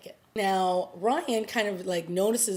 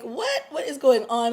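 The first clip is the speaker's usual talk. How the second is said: a sharp high yelp of "What?", then confused; shocked disbelief; 6 semitones higher, 5 dB louder than usual. Retold or imitated. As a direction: play the baffled ex hearing gossip about herself. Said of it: "So Sarah's like".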